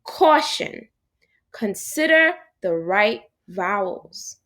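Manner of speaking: spelled out slowly like a warning sign; slow, emphatic warning-sign read. Shown as a demonstration. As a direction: read it like a posted caution sign, deliberately. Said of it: "I'm gonna repeat what I said".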